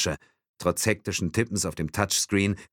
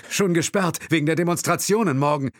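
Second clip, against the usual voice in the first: high, loud, hurried answer; agitated explanation; 11 semitones higher, 5 dB louder than usual. Acted. Driver explaining the empty road, loud and agitated. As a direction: rattle off the explanation, keyed up.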